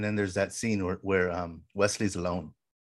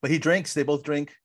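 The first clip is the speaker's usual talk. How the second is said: normal talking voice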